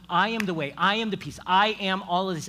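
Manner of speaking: loud declaration with rising urgency; crying out. Acted. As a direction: proclaim it to the crowd, punching each I. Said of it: "he's crying out to them".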